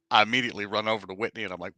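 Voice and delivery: ordinary talk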